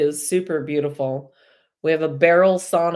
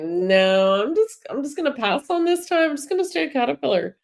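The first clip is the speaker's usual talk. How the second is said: much higher, fast, petulant little caterpillar; 9 semitones higher than usual. Pretend. Teacher voicing a caterpillar refusing to change, high squeaky voice.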